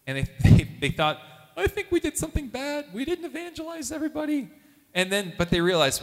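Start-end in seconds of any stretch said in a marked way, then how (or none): 1.5-4.5 much higher voice, anxious students fretting; worried students. Retold or imitated. Youth group students fretting to each other, higher worried voice. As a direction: students afraid they did something bad, higher.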